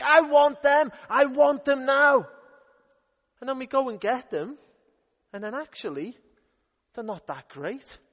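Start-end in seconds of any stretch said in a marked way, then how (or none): 0.0-2.3 put-on high, loud childish demand; greedy child. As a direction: whine it like a greedy kid. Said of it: "we think".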